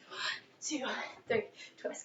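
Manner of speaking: breathy whisper, counting reps. Shown as a demonstration. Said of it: "Okay, I'll stop".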